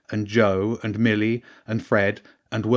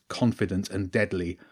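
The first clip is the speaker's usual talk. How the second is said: ordinary delivery, nothing marked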